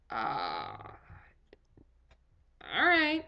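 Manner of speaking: stall, then give in awkwardly; uneasy reluctance. Retold or imitated. Patient told to undress before watching doctor, uneasy.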